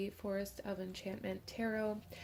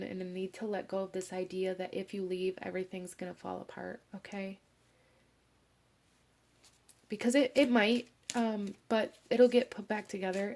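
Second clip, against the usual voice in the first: normal talking voice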